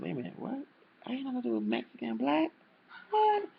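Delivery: high-pitched, baffled double take; squeaky-high, incredulous questioning; retold disbelief. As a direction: replay a startled reaction, pitch leaping up. Retold baffled reaction to lyrics.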